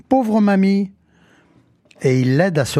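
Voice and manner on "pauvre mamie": high, drawn-out cry of pity; exclaiming. A narrator's reading. Petit Pierre to the fallen witch, exclaiming. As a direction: cry out as a little boy. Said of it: "Petit Pierre s'écrie".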